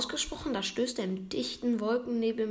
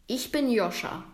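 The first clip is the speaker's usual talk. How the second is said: ordinary delivery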